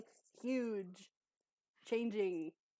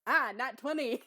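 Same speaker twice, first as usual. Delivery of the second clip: loud, higher-pitched delighted outburst; real excitement; 5 semitones higher, 11 dB louder than usual. Real, not acted. Player announces a top roll to the table, thrilled. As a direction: burst out at the lucky roll. Player rolls a natural twenty.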